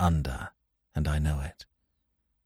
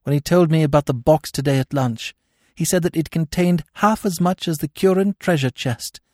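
high, emotional confession building in pitch; growing emotion; 10 semitones higher than usual. A narrator's reading. Captive woman confessing to Smith, growing emotion. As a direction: let the distress build as you confess. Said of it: "her emotion growing momentarily greater".

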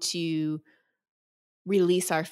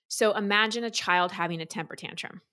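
ordinary talk, nothing marked

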